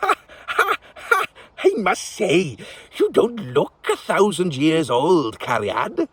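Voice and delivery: put-on high old witch voice, amused; amused old witch, teasing a king; cackling old witch. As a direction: crow with laughter like an old witch.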